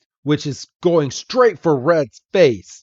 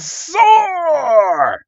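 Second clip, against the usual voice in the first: much higher, loud, drawn-out monster cry; creature battle shout; 5 dB louder than usual. Acted. Bulbasaur firing Solar Beam at Machoke, loud high battle shout. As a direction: unleash the attack with a huge howl. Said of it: "And then Bulbasaur goes".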